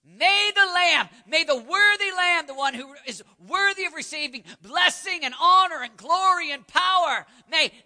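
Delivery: loud, high preaching shout; roaring anthem. Retold or imitated. Preacher voicing young missionaries giving their lives, roaring. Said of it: "Listen to the roar".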